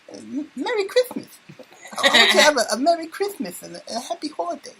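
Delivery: high, lisping Mike Tyson voice; Mike Tyson impression. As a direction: do a squeaky Tyson wishing merry Christmas. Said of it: "in my Mike Tyson voice".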